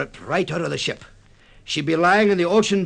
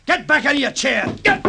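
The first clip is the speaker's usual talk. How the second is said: a high, forceful order; stern command; 11 semitones higher, 4 dB louder than usual. Acted. Captain forcing the shipowner back into his seat, in a sharp command. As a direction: push him back down with your voice.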